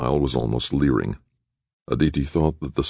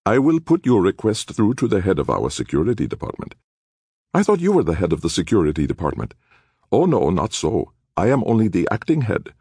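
lightly voiced bland official; a hint of a smug clerk; polite bureaucrat; 5 semitones higher than usual.

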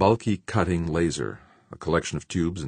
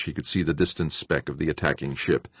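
usual speaking voice

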